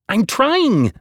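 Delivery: high, loud frantic yell; yelling back. A narrator's reading. Narrator yelling back to Hazel, frantic. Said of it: "I yelled back".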